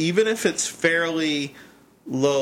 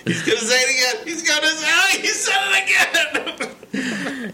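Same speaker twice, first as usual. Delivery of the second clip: climbing high and breaking into laughter; mounting anticipation; 13 semitones higher than usual. Retold or imitated. Cohost recalling waiting for the repeated word, giddy mounting excitement. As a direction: build higher to a laughing squeal of payoff. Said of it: "I was like".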